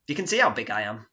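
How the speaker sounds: ordinary talk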